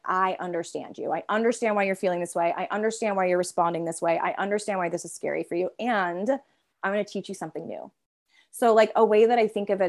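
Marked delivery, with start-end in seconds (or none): 0.1-7.9 put-on softer, gentle reassurance to an inner child; soothing reassurance. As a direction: talk softly to a scared child, calming it. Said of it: "really helping that part understand".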